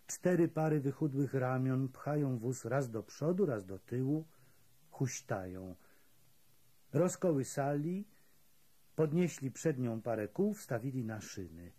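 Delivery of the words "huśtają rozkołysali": usual speaking voice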